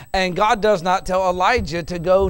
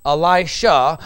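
ordinary delivery

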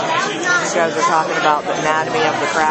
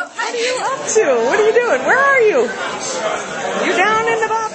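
high sing-song mom voice. Real, not acted. Mother calling to her child.

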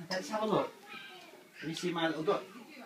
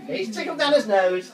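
delighted praise; 8 semitones higher, 11 dB louder than usual. Real, not acted. Praising baby after the nose tickle.

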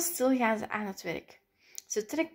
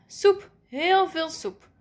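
slow, higher, savoured announcement; emphatic, stretched-out declaration; triumphant reveal; 6 semitones higher, 4 dB louder, slower than usual. A narrator's reading. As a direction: draw out each word proudly.

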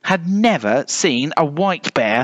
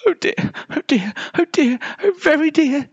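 very high, anxious little voice; high-pitched worried bear; fretting polar bear. A narrator's reading. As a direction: fret to yourself, high and tight. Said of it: "she whispered to herself".

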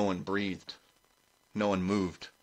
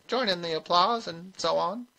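put-on high-pitched, mild old witch; high, unassuming little voice; innocent old witch; 11 semitones higher, 3 dB louder than usual. A narrator's reading. Granny voiced in the story.

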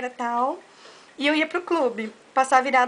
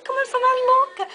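incredulous; 10 semitones higher than usual. Retold or imitated. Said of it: "Eu falei".

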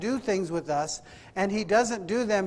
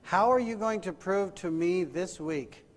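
soft, lowered, searching questions; hushed preaching; 4 semitones lower, 8 dB quieter than usual. Acted. Preacher challenging congregation about this week, hushed solemn preaching. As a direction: bring it right down and let each question sit.

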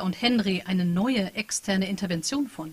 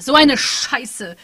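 much louder, very slow; angry curse; 4 semitones higher, 9 dB louder, much slower than usual. Acted. Patricia cursing with tears of rage, loud. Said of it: "Patricia fluchte".

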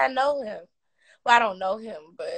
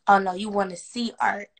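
ordinary talk, nothing marked

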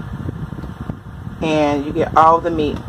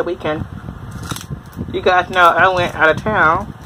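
chewing while talking; biting food mid-sentence; mouth full. Real, not acted. Seen 2: Peels crab first, then holds crab at mouth, biting, from 3.9s.

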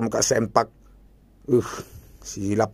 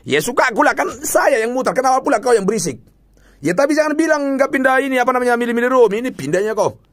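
heated retort; 11 semitones higher than usual. Real, not acted. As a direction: snap back defensively. Arguing with off-screen voice. (membalas dengan kesal)

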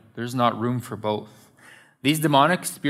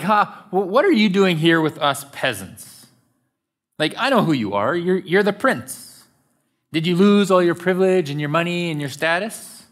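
raised, higher-pitched jeering; mocking yell; 6 semitones higher, 4 dB louder than usual. Shopper heckles fallen royals in Walmart, mocking yell. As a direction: call out in mock disbelief, taunting. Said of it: "we yell out mockingly".

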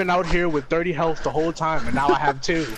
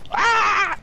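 sudden high startled scream; startled yelp; 5 dB louder than usual. Real, not acted. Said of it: "That was the most unnecessary ah".

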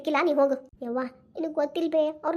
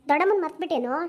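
ordinary talk

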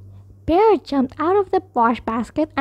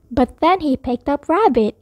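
plain reading voice

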